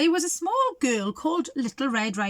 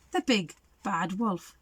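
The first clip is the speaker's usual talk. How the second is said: ordinary delivery, nothing marked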